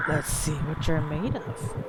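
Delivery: slow low creepy whisper-voice. Shown as a demonstration. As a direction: read it slow and menacing. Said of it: "I don't know if I can make a hoarse voice".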